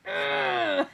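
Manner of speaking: a high, strained cry of dismay; mock anguished cry. At the auction pile, a hammed-up anguished ugh. Anguish over winning her boxes.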